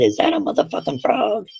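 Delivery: high-pitched appalled question at the tattoo photo; can't believe what she's seeing; a retold gasp of disbelief, high and incredulous; shocked disbelief. Mom shocked at frog picture.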